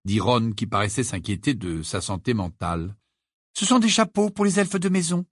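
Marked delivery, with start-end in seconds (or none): 3.5-5.3 curt, high reply stressing sont; brusque. Acted. Hermione curtly answers Ron, brusque. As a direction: snap back the answer briskly. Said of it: "d'un ton brusque".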